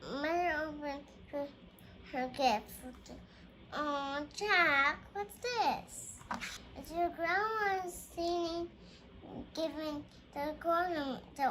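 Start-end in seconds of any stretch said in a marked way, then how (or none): none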